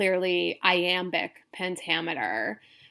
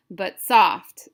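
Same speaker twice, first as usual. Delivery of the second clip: "but" light, "soft" stressed and louder; 8 dB louder than usual. Shown as a demonstration. Teacher scanning a Romeo and Juliet foot, stress on "soft". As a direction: lean on "soft" after an unstressed "but". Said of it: "So we have unstressed, stressed".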